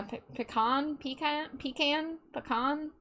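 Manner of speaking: PECAN alternating "pi-kahn" and "pee-can"; trying pronunciations. Shown as a demonstration. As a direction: test each PECAN with a questioning rise. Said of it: "I think I say pecan".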